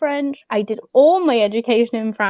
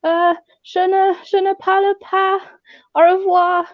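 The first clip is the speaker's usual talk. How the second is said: put-on halting French with a heavy English accent, pitched higher; bad English-accented French; 7 semitones higher, 3 dB louder than usual. Dodging a street seller, faking bad English-accented French. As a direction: fake a clumsy English tourist stumbling through French. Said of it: "really bad French with an English accent".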